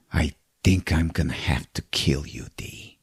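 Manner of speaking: whispered death threat; threatening whisper. Acted. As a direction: breathe the threat out softly. Lou threatens Dee.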